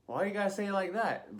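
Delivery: high-pitched, exasperated complaint; mock-aggrieved whine at the doctor; indignant protest. Retold protest after a scare.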